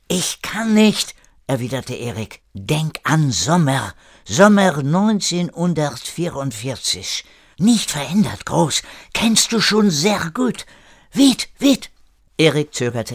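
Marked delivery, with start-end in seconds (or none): 0.1-1.1 high, slow protest; helpless protest. Acted. Erik unable to follow René, high helpless protest. 2.5-11.9 put-on high, urgent French-accented German; French-accented René. René hurrying Erik back in time, urgent French accent.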